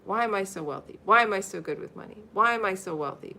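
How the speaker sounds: normal talking voice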